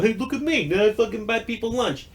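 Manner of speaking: high, smug show-off voice; mock bragging. Mocking the idea of bragging about buying lunch, high and smug. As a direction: parody a self-congratulating do-gooder.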